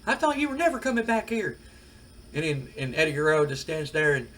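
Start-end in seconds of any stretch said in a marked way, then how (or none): 0.1-1.6 much higher, loud shocked outburst. Retold or imitated. Manager shocked to see the returning wrestler, high disbelief. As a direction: squeal it out in disbelief. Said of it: "Jim Cornette is shocked. He, he was like".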